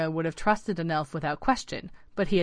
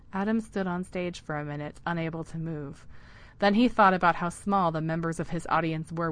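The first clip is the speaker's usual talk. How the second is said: plain reading voice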